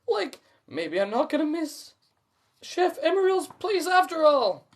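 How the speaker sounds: high, loud happy Shaggy. A narrator's reading. Shaggy reaching for food, high and pleased. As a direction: grin about staying after all. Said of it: "Shaggy says".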